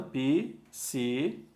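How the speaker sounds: "psicólogo" spelled out slowly, syllable by syllable; slow, spaced syllables in "psicólogo"; syllable division. Shown as a demonstration. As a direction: break "psicólogo" into separate beats with pauses. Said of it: "Mas na separação".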